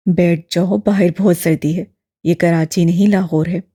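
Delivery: lightly voiced old man, friendly; familiar, warm old man. A narrator's reading. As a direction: treat him like family. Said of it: "बड़ी अपनाइयत से कहा".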